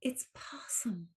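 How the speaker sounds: whispered correction; whispering. Real, not acted. Correcting the word quietly.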